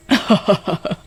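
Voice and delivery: witch's laugh. Acted.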